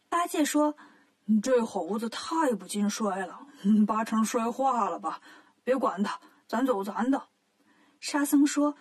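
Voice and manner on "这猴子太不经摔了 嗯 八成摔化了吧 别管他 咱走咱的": lightly voiced smug Bajie; sly Bajie. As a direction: brush it off with fake innocence. Bajie brushing it off.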